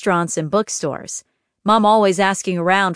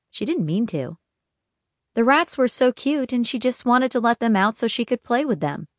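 ordinary delivery, nothing marked

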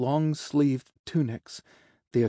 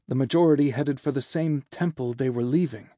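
ordinary delivery

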